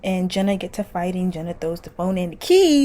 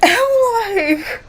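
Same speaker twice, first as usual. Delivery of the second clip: high, loud laughing squeal; 11 semitones higher, 6 dB louder than usual. Real, not acted. Laughing at Jason's line, high loud squeal. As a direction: shriek with laughter.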